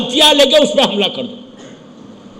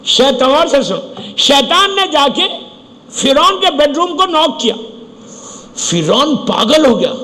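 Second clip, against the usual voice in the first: ordinary delivery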